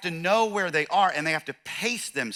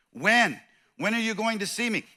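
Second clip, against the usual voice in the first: alarmed disciples; 7 semitones higher than usual. Retold or imitated. Disciples' anxious question acted.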